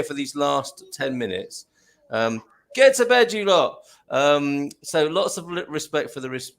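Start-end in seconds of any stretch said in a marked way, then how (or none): 2.7-3.8 calling out. Real, not acted. Calls to absent children.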